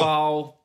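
"Bow" held high and level; first tone. Shown as a demonstration. Guest shows host how "Bow" is pronounced, first tone. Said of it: "Because its first tone".

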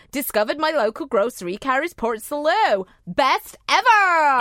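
a gushing northern fan, Best ever stretched out; Melissa's voice. Retold or imitated. Reading the fan's line as Melissa would, gushing northern accent. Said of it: "So she's actually saying".

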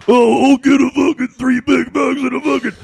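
high, loud hammed-up customer ordering; mock the driver's order over the headset; mocking impression. Crew imitating a drive-thru customer to each other, high loud mockery. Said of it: "do an impression of that person".

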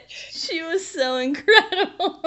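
words squeezed out through laughter, slow; laughing helplessly as she speaks. Real, not acted. Laughs recalling the tailor.

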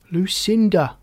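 first attempt to get attention; calling out. A narrator's reading. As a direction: call "Lucinda" across a crowd. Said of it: "called Frog".

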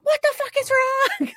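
high, loud imitation of a panicked husband; mock yelling. Charles yelling at his wife when upset, mock yell. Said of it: "like, yelling at her".

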